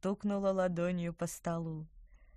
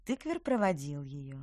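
usual speaking voice

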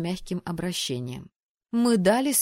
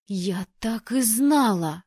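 slow, spiteful hissed line; jealous sister hissing; venomous hiss; 3 semitones higher, 5 dB louder, slower than usual. Acted. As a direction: spit it out with venom. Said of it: "ядовито прошипела Белла".

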